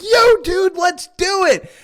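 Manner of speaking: loud, high, pumped-up call to a friend; excited shout. Retold or imitated. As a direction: yell it like you're hyping up a buddy. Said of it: "I'm like".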